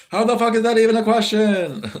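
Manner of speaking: loud, high-pitched exclamation; exasperated high yell; incredulous outburst. Real, not acted. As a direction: burst out loudly in high-pitched disbelief. Mock outrage at a chat question.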